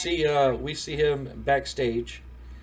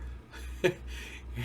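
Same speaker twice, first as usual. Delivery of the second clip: soft breathy laugh; 5 semitones higher, 6 dB quieter than usual. Real, not acted. Laughs at own story.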